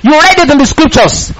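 ordinary talk, nothing marked

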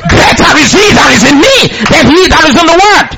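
high, fervent declaration; emphatic response. Shown as a demonstration. Believer responds to the scripture, boldly declaring. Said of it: "No, respond".

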